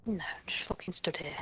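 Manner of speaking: whispering. Real, not acted. Sarcastic whispered retort.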